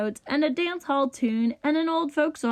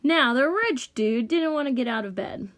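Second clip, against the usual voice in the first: ordinary delivery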